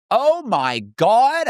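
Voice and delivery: high, drawn-out exclamation; disgusted wail. Retold or imitated. Smelling the filthy bathroom from outside, disgusted wail. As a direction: stretch it into a mock-appalled wail.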